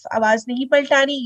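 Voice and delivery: ordinary delivery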